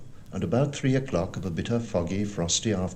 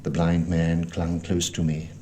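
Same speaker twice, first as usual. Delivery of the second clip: plain reading voice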